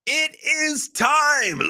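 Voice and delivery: high, slow, shouted announcer call. Pretend. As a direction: boom it like a ring announcer. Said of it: "I don't wanna keep that up".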